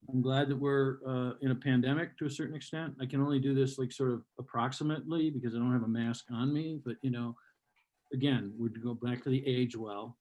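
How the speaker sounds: ordinary talk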